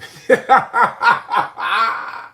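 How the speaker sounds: loud high laugh. Real, not acted. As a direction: burst out laughing. Laughs at the quote.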